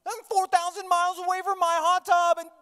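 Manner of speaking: high, loud hammed-up yell; panicked father's outburst. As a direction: build from a high curse to a full shout, dragging the end. Father realises Dylan is with daughter.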